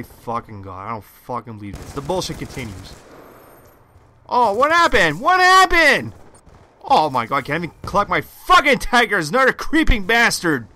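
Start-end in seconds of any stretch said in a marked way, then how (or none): 4.3-6.1 raging gamer. Real, not acted. Gamer yelling at sudden death. 6.8-10.7 raging gamer. Real, not acted. Gamer cursing enemy players.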